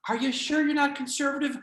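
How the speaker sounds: high, insistent telemarketer voice; pushy telemarketer. Acted. Telemarketer presses a person about their denomination, pushy. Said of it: "a very aggressive telemarketer".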